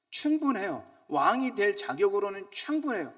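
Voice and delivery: loud, high, insistent sermon cry; fervent preacher proclaiming; preaching at full force. Acted. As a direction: drive it home to the congregation. Preaching climax.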